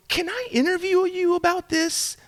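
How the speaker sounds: high, careful polite asking; tentative request. Retold or imitated. Reporter asking homeless men for an interview, tentatively. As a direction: gingerly float the request, voice lifted.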